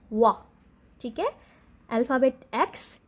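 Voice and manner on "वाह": वाह with rounded, pushed-out lips; phonic w sound. Shown as a demonstration. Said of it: "lips को गोल घुमा के".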